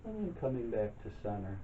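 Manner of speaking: calm, unhurried meditation guide, long pauses between phrases; guided meditation. Real, not acted. Leads breathing and chair yoga.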